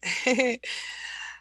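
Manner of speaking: light airy laugh; a quick soft laugh to herself. Real, not acted. Laughing at herself mid-note, soft laugh.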